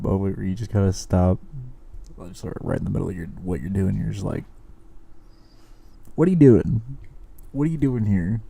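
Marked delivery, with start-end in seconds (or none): 6.1-8.4 higher, louder mock challenge to himself; incredulous self-questioning. Man asking himself what he is doing here, incredulous. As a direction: question yourself in mock disbelief.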